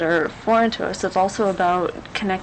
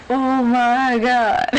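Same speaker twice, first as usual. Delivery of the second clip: slow, loud, higher "Oh my God" of disbelief; amazed gasp; 5 semitones higher, 5 dB louder, much slower than usual. Retold or imitated. Host recalling the French guest's Corvette, in stretched-out amazement. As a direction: drag it out in retold astonishment.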